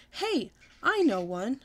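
slow, eager, bright-eyed announcement; very excited. Acted. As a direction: draw it out like a big idea. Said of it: "I said, very excited".

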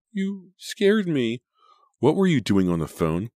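high, loud, upset reproach; alarmed scolding. A narrator's reading. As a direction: scold him while still frightened. Jenny confronts boy on phone.

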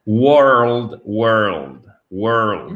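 "world" drawn out, sound by sound; carefully modelled "world"; effortful articulation. Shown as a demonstration. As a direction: open the mouth wide on "world". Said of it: "Il faut vraiment ouvrir la bouche".

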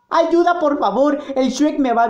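high, panicked cry for help; screaming for help. Acted. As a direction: yell desperately for rescue. Victim calls out for help.

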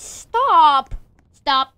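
loud shouted stop. Real, not acted. Gamer shouting at a player shooting him. Being shot.

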